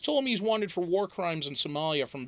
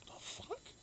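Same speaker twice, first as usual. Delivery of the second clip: incredulous whisper; 14 semitones higher, 21 dB quieter than usual. Retold or imitated. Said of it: "And I'm like".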